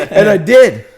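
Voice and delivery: loud, high burst through laughter; triumphant laughing. Real, not acted. Admitting he brought the batteries back, laughing loudly. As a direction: crow it while cracking up.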